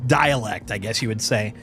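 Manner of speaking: ordinary talk, nothing marked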